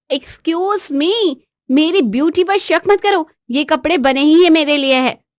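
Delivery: drawn-out, high 'excuse me' indignation; haughty wife. Acted. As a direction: defend your beauty with diva airs. Wife defends her beauty.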